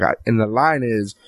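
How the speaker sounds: ordinary talk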